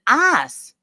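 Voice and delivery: "Ice" said high and loud, as a drawled Southern "Ahs". Retold or imitated. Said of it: "teach me how to pronounce ice".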